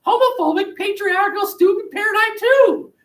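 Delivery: put-on high sugary kid voice, proud of her words; little girl Sally. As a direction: chirp it like an eager small child. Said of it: "says Sally".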